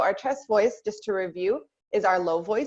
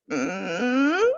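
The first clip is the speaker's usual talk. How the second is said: creaky mm gliding upward; rising fry-to-hum creak; squeaky door hum; 3 semitones higher than usual. Shown as a demonstration. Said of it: "almost think like a squeaky door".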